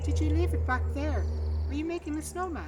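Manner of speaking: loud, high, fast anxious questions; anxious mother. A narrator's reading. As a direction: press the child, voice rising in panic. Mother pressing child.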